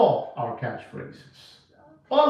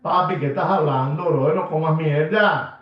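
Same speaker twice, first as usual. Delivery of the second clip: usual speaking voice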